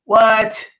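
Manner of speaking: high, loud burst of disbelief; stunned what. Retold or imitated. Learning the visit was remembered.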